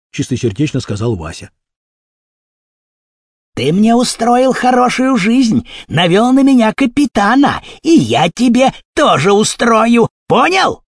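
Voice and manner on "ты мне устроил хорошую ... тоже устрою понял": high, drawn-out menace; threatening. A narrator's reading. Kurochkin threatens Vasya over the captain, menacing. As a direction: build the threat to a high final demand. Villain threatens boy in story.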